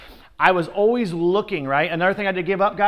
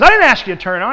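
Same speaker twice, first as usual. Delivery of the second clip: loud insistent correction; mentor retort; 4 semitones higher, 8 dB louder, much faster than usual. Retold or imitated. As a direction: press the point hard. Mentor retorting in shouted exchange.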